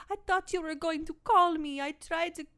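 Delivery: high, pleading lovesick voice; needy girlfriend voice. Retold or imitated. Girlfriend pleading with Lionel, high and lovesick. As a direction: beg him like a lovesick girlfriend. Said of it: "she's like".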